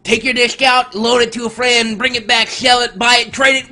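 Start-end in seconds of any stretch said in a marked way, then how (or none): none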